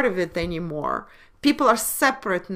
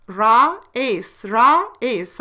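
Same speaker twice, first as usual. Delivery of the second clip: Ra and ace given in separate punched beats; loud syllable-by-syllable breakdown; syllables spelled out; 4 dB louder, slower than usual. Shown as a demonstration. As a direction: tap out ra, ace, ra, ace like a teacher. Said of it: "ra and then two a's merged".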